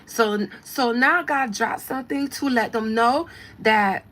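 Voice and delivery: loud imitation of her accent; lively put-on accent, punched words; [name]. Commentator channels Cardi dropping something, in her exaggerated accent. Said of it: "This is me channeling her".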